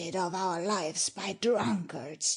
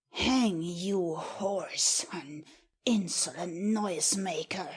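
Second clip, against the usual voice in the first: hissed insults under the breath; whispered curse. Acted. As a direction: spit the insults out in a harsh whisper. Lord curses the boatswain.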